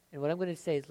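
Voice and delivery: normal talking voice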